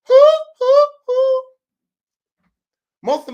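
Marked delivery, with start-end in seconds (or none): 0.1-1.4 high shrieking hoo hoo hoo; hammed-up ride shriek; coaster whoops. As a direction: scream like on the drop. Roller coaster scream.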